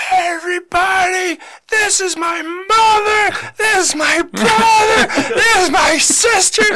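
high, loud, slow announcing yell; screaming into a mic. Retold or imitated. Brother yelling to the church into a tiny mic. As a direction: scream the family introductions into the mic. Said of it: "he screams into it".

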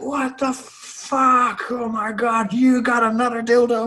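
very high, stretched-out exasperated wail; mock steaming outrage. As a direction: imitate a man blowing his top, words drawn out. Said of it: "like, blow steam".

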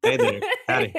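high, laughing Southern cowboy greeting; mock Texan hello, very high and bright; hammed-up Southern howdy. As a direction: play up the Southern accent for a laugh. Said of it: "your Southern drawl just came out there".

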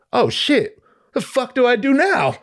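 high-pitched acted alarm; mock panic. Performer arriving on stage, mock-panicked. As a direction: suddenly realize you have no plan.

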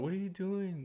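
soft, high, gentle lilt; calm, sing-song asking; super calm. Retold or imitated. Wife talking to her baby daughter, super calm. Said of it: "Like, super calm, by the way".

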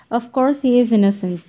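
detached, uninterested delivery; cool and offhand; indifferent. A narrator's reading. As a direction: toss it off as if it hardly matters. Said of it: "she answered indifferently".

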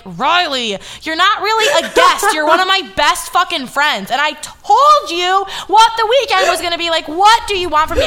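fed up and pleading; to her friend, exasperated; exasperated retort. Retold or imitated. Retold argument with friend.

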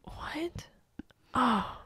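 stunned, breathless what; shocked what. Retold or imitated. Retelling to friends, stunned breathless what. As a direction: act out the shock of the news. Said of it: "and I go".

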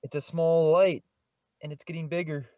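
ordinary talk, nothing marked

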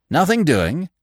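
high, rising question; inquiring. A narrator's reading. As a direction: ask hopefully on a rising tune. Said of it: "he inquired".

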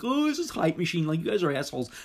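high-pitched mocking quote; mock naysayer. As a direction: parody a dismissive complainer. Mocking jaded naysayers' dismissive reaction.

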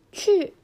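去 sharply falling from high, said loud; 去 punched down from the top of the voice; fourth tone demo. Shown as a demonstration. Teacher showing the fourth tone on 去, loud and falling. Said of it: "去は四声です".

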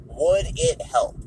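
slow, every word given weight; slow, pointed "Would it help?" with "Would" stressed; slow and weighty. Shown as a demonstration. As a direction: let the question sink in slowly. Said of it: "W I H".

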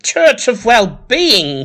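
high, slow, doubtful echo; librarian repeating. Acted. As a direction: echo the name like it smells. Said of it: "she says".

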